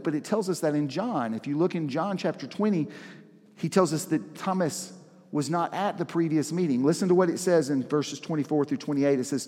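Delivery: ordinary talk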